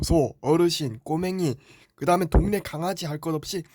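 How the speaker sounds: ordinary delivery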